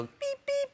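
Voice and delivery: very high electronic beeps; imitated monitor beeps. Medic hooking him up to everything, imitated beeping. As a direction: squeak out two machine beeps.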